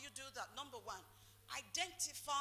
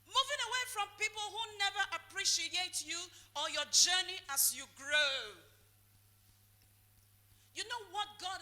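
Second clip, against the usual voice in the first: loud, higher, driving sermon; preaching at full force; 4 semitones higher, 7 dB louder than usual. Real, not acted. Preacher urging congregation to grow, loud and high. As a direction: preach it out with fire.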